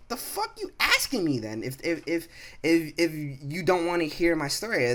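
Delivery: high-pitched, incredulous retort; acted outrage, stammering on the ifs; indignant. Worker fires back at rude coworker, high indignant retort.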